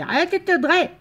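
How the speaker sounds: high, loud little cat voice scolding; mock cat voice scolding; cat's voice. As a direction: squeak it out as the cat telling her off. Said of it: "qui dit".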